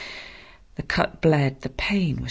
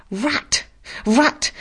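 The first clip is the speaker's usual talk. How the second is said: higher yelled insult; bellowing; 10 semitones higher than usual. Acted. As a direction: bellow Rat! at her. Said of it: "bellowed out aloud".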